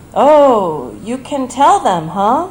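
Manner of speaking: a hint of an elderly mother struggling to speak; slow thick-tongued. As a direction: lightly mimic a thick tongue. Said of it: "Her voice is slow and her tongue is thick".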